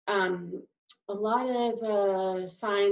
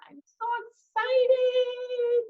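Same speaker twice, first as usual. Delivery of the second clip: much higher, slow gushing I'm so excited; gushing excitement; much slower than usual. Shown as a demonstration. Sign teacher acting out eager anticipation, gushing. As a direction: squeal it out like you can't wait. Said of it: "you get an excited look on your face".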